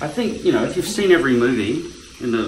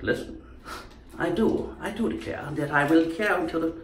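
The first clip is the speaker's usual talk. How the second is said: put-on higher, royal old English voice; old English accent; 4 semitones higher, 4 dB quieter than usual. Speaker declaring like the Queen, old English accent. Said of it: "Queen's current accent probably is the old English".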